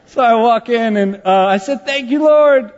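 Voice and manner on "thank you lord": high, drawn-out, sung-out praise; too loud. Retold or imitated. Pastor thanking God at a sleeping patient's bedside, too loud. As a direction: let the thanks ring out too loudly. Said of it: "Oh, that was too loud".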